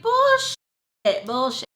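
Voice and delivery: high, loud mock outburst; scoffing shout. Answering grandma with a high, loud scoff. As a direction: blurt it out in disbelief. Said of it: "And I'm like".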